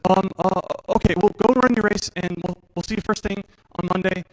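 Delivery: panicked doctor. Retold or imitated. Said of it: "seem panicked".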